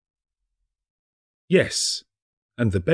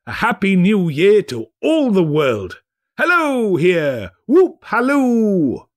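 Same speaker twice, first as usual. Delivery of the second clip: higher and louder, whooping at the end; a merry old man shouting his joy; giddy Scrooge; 7 semitones higher, 4 dB louder than usual. Acted.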